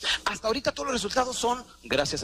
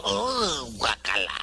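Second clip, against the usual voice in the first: usual speaking voice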